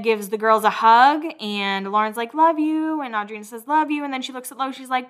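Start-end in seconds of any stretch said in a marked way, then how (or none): 2.3-3.0 high-pitched imitation of a sweet farewell; retold fond goodbye. Retold goodbye between friends. 3.7-4.0 high-pitched imitation of a sweet farewell; retold fond goodbye. Retold goodbye between friends.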